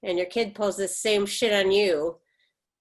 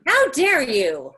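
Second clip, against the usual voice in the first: outraged; 3 semitones higher, 7 dB louder, slower than usual. Acted. Mother reacts to insult.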